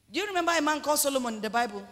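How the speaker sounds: ordinary talk, nothing marked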